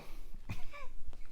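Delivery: small real laugh; short laugh. Real, not acted. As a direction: laugh briefly on the breath. Own laugh after "It's a no.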